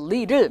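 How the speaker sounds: "little" as "li-rul"; American flap T. Shown as a demonstration. Teacher shows American T, "little" as li-rul. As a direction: tap the t of "little" like r. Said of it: "T 사운드를 R 사운드에서 가깝게".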